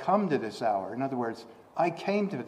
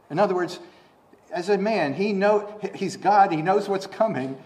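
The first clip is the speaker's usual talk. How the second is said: normal talking voice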